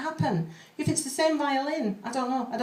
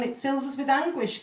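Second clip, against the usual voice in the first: ordinary talk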